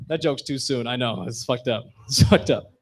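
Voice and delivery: normal talking voice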